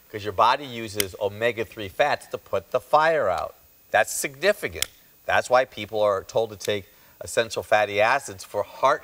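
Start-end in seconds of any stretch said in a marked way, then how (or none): none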